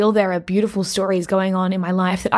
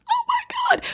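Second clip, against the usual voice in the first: high-pitched gasp of delight, much higher; excited squeal. Real, not acted. Gushing over mum's craft room to viewers, high excited squeal. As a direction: squeal it like you can't believe how pretty it is.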